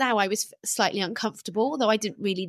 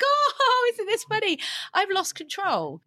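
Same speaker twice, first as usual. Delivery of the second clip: high-pitched mock laughing outburst; 10 semitones higher than usual. Laughing about her own overeating to the others, laughing it off. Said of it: "I would laugh about it".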